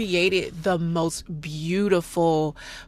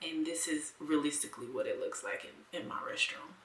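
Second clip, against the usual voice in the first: soft, half-whispered camera talk; hushed; 15 dB quieter than usual. Real, not acted. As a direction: murmur while adjusting the exposure. Filming exposure settings close up.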